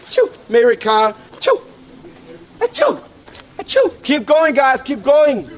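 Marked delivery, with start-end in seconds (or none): 2.6-4.0 high mock sneeze; hammed-up pretend sneeze; fake sneeze.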